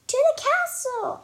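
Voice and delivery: high, loud joyful shout. Acted. Dory shouting her news to Mom, loud and high. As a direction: belt it out to the whole house. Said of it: "I shout".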